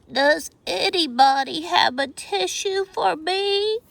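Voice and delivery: high, pitiful little flea voice; garbled plea. A narrator's reading. The flea pleading for a tissue, high and pitiful. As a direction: beg for a tissue in a tiny whiny voice. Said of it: "his garbled plea".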